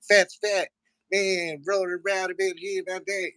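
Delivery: very high squeaky rapper voice; high-pitched nerdy rapper. Retold or imitated. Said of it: "he has a real high-pitched voice".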